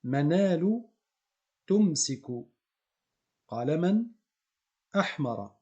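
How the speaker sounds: Arabic words said loud and separated; model reading. Shown as a demonstration. Teacher reads the reversed sentence aloud to the class. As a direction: read each word out for learners. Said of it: "on a juste inversé".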